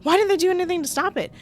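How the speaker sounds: high, loud, indignant outburst; worked-up, incredulous ranting; exasperated rant. Real, not acted. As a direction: fire back in disbelief, high and loud. Heated rant about the question.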